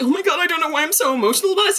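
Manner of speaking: breathless overwhelmed fan; overwhelmed gush. Retold or imitated. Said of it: "I was weeping".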